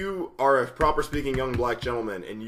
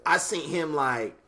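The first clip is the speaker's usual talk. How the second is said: higher, louder street persona voice; Jamichael voice; 6 semitones higher, 4 dB louder than usual. Retold or imitated. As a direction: slip into the loud street persona. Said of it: "sometimes I'm Jamichael".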